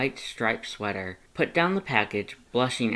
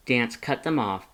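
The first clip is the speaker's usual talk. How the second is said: plain reading voice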